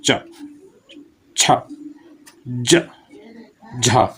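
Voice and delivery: च, छ, ज, झा said one by one with pauses; affricate series demo. Shown as a demonstration. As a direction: space out च छ ज झा clearly. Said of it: "जैसे चा बोलेंगे ना". (च छ ज झा रुक-रुक कर बोलना)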